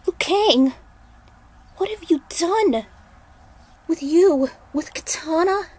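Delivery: stunned, begging him to explain; a lightly voiced distraught girl; shocked Aiko. Aiko asks what he has done.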